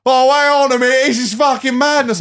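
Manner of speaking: high, loud mock alarm; sudden realisation, hammed up. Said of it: "we're like".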